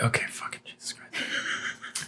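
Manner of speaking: under-the-breath whisper, slow; whispered exasperation. Retold or imitated. Cursing the slow repairman to herself in a slow whisper. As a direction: whisper it so he can't hear.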